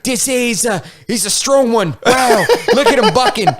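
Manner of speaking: high-pitched Australian crocodile hunter impression; Steve Irwin. Crocodile hunter character pinning someone down, excited Australian impression. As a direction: narrate a wild catch in a put-on Aussie voice. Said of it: "he would be talking like Steve Irwin".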